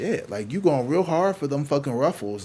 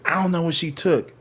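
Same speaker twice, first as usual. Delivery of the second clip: higher mock protest, mildly heated; real angry clerk; 6 semitones higher, 3 dB louder than usual. Clerk rejecting the boyfriend's offer, lightly mimicked anger. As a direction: imitate an indignant clerk, pitch raised. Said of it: "he had, like, this real angry".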